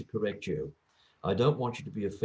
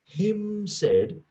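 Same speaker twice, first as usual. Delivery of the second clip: "Him" stretched high, then dropping; stress on Him; 12 semitones higher, 5 dB louder than usual. Shown as a demonstration. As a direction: draw out and lift the word "Him". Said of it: "we don't use him there".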